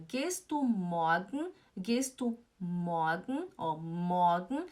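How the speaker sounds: MORGEN as "MAW-gn", no R, slow; slow model "morgen", lifting at the end; light A sound. Shown as a demonstration. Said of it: "Tem um leve som de A".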